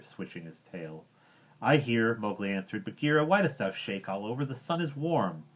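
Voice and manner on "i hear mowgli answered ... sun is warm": put-on boy voice, higher and louder; a hint of a curious young boy; Mowgli's voice. Mowgli asks why Bagheera shakes, higher boy voice.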